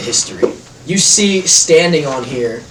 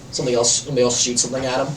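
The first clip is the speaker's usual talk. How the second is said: ordinary talk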